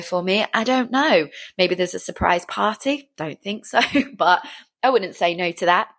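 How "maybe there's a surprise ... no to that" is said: normal talking voice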